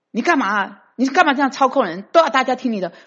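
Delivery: the driver fed up with backseat orders, irritated; retold annoyed complaint. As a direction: snap back at the controlling passenger. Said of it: "那个人也很生气".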